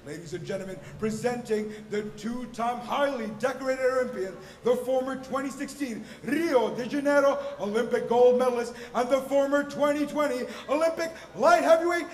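building to a booming stretched name; rising hype into the fighter's name; lucha libre announcer. Acted.

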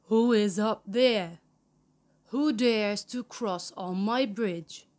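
loud, menacing troll growl. A narrator's reading. Wicked troll challenging a goat on his bridge, loud growl. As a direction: growl it out at an intruder. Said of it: "The troll growled loudly".

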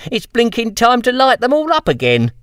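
chuckling through the punchline; laughing. Acted. Snotty joking about lighting the lamps again, laughing. Boy laughs at his own joke.

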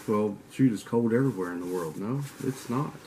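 normal talking voice

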